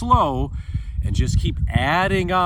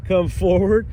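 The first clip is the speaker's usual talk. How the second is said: ordinary talk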